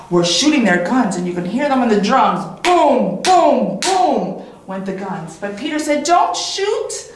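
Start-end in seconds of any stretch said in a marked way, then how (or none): 2.6-3.8 mock booms. Guns imitated like drums. 6.0-7.1 urgent plea. Acted. Peter stops the hunters.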